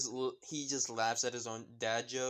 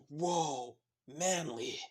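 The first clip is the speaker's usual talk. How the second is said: put-on a touch of awe in a boyish voice; lightly voiced Kirishima going whoa; impressed exclaim; 2 semitones higher, much slower than usual. Fan narrator voices impressed Kirishima.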